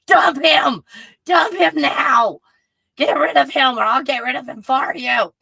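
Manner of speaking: loud, emphatic shouting; shouted command. Real, not acted. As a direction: yell it at her like an urgent order. Shouting breakup advice to commenter.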